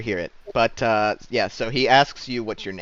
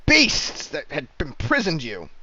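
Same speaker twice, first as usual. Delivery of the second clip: raised, loud knightly proclamation; emphatic paladin; 4 semitones higher, 7 dB louder than usual. Acted. Paladin answering Thane about the fiends, loud and emphatic. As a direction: declare the horror with righteous force. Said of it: "the paladin".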